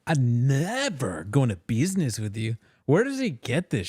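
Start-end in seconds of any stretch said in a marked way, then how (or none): 0.1-2.6 slow, lightly put-on imitation; mocking mimicry. Host mocking the comedian's line, slow mimicry. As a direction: mimic his stupid delivery, stretching it out. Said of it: "He did the stupid".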